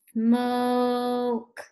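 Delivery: SMOKE with the O held long; drawn-out slow. Shown as a demonstration. Teacher asking what pupils hear, drawn-out slow SMOKE. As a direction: stretch SMOKE out on one steady note. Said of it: "Say it slow".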